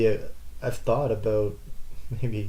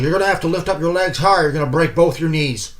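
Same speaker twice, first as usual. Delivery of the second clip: higher, louder blunt instructor; 5 semitones higher, 5 dB louder than usual. Retold or imitated. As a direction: bark the warning flatly. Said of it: "Just says it bluntly like that".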